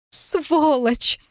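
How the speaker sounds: high-pitched, tearful rage; high outburst of helpless anger; furious curse. A narrator's reading. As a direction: hurl the insult in a high voice. Raging and crying on the floor.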